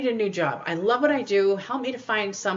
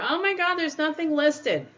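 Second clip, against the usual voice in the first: mock whine; 9 semitones higher than usual. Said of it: "I don't wanna hear".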